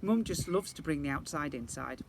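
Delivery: usual speaking voice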